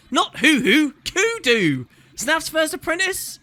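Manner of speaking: high, loud and emphatic; exasperated correction. Acted. Player correcting a deaf councillor, loud and exasperated. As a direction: spell it out for someone hard of hearing.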